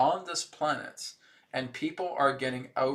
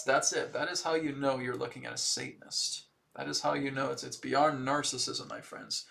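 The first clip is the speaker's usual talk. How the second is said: ordinary talk, nothing marked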